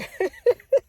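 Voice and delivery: sharp high laugh; squealing laugh; high incredulous laugh. Real, not acted. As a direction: laugh in disbelief. Laugh of disbelief.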